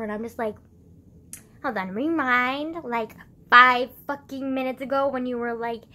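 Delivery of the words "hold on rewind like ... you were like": angry retort. Retold or imitated. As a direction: push back hard on him. Retold angry retort to dad.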